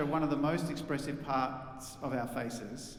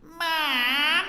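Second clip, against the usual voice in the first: put-on bright twangy childish call of Mom; 10 semitones higher, 5 dB louder than usual. Shown as a demonstration. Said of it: "I'll show you what twang is".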